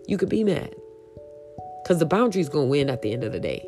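ordinary talk, nothing marked

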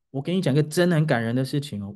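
ordinary talk

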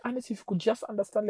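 high, quick imitated plea; pleading. Wife begging upset husband to understand, pleading.

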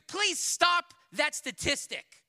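loud, high, slow plea; urgent encouraging. Real, not acted. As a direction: implore them, drawing the words out. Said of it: "I'm encouraging you".

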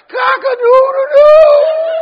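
very high, slow, loud crowing; rooster crow. Retold or imitated. Rooster crows in story.

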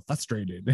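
"Frustrated" as FUS-trated. Retold or imitated. Guest imitating the host's enunciation, first R dropped. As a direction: go straight from F to U in "Frustrated". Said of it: "when you enunciate it a lot".

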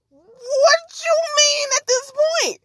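high, slow, drawn-out incredulous question; baffled disbelief. Acted. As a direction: stretch it out high in exasperated disbelief. Incredulous at the woman's claim.

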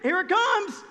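mock panic of a besieged city; freaking out. People inside Jericho panicking as the ark circles, mock fear. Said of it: "freaking out 'cause they're going".

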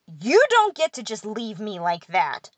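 loud, slow furious scolding; angry. A narrator's reading. Pixie scolding his fairy for leaving him behind. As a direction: tell him off, hurt and furious. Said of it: "pointed his blue finger at me angrily".

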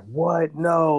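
sudden high alarmed cry; freaking out. Retold or imitated. Kevin seeing the crash news, shocked high cry. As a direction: blurt it out in shock, high and loud. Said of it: "like, freaking out".

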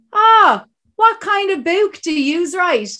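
high, lilting Dublin cabbie; Dublin accent. Retold or imitated. Taxi driver greeting an author in Dublin accent. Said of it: "the Dubs have an accent".

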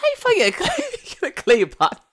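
high, loud, incredulous squawk through laughter; hammed-up astonished outburst, cracking up; laughing disbelief. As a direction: retell your bafflement as a laughing yelp. Retells his baffled reaction.

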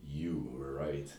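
slow, quiet, reluctant; grudging admission. Shown as a demonstration. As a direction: drag the words out grudgingly. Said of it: "One more time".

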